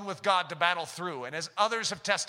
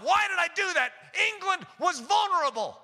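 much higher, dismayed self-reproach; regretful Hitler; 11 semitones higher than usual. Retold or imitated. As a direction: Hitler kicking himself over a mistake. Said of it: "he looked back and he hit himself".

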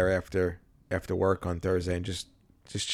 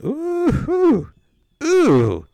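excited cooing; 7 dB louder, much slower than usual. Acted. Gushing over a memory.

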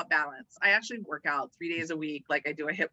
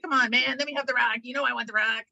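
higher-pitched voice of a pestering crawfish; acted-out begging, pitched up; pleading crawfish; 5 semitones higher than usual. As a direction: play a crawfish wheedling for its turn. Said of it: "the other one was like".

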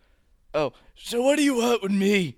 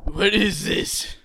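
usual speaking voice

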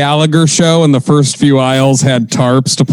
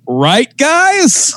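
proud assassin; 11 semitones higher than usual. Retold or imitated. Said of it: "turns around and is like".

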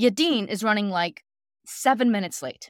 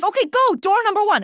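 high, loud, urgent command; stressed-out usher; 12 semitones higher, 7 dB louder than usual. Retold or imitated. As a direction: send them running, as the frantic usher. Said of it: "usher is so stressed out".

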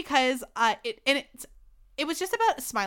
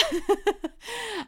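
high laugh; 10 semitones higher than usual. Real, not acted. Laughing at her own flirting.

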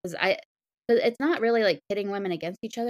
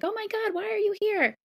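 very high-pitched, startled gush; mock astonishment. Parents finding a famous hero at home, astonished. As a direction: squeal it as a hammed-up shocked parent. Imagined parents reacting to Carol.

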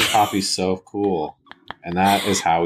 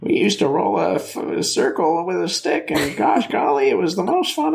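put-on old man voice; 12 semitones higher than usual. Answering the cane joke with a high old-timer voice. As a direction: ham up a cane-shaking grandpa. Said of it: "shaking your cane at me".

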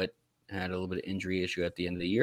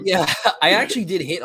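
laughing boast, raised and louder; 8 semitones higher, 6 dB louder than usual. Real, not acted. Host admits a lucky draft hit, laughing. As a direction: come out of a laugh into the words.

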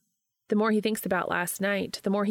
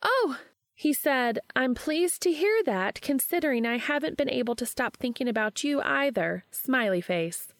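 higher voice, mock-formal reading of a text; Charlie, teasing friend; 7 semitones higher than usual. A narrator's reading. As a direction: tease him by reading it out. Charlie reads Cass's reply.

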